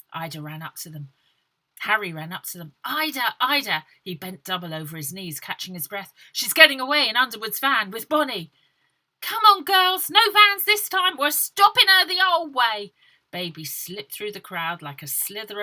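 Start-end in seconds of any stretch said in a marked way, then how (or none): 2.8-3.9 louder urgent call. Acted. Harry calling out. 6.3-8.5 loud breathless warning; loud, out-of-breath warning; urgent warning. Acted. 9.2-12.9 high, loud battle cry; rally the troops; high, loud rallying shout. Acted. Baby rallying the girls.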